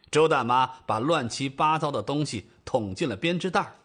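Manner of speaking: ordinary delivery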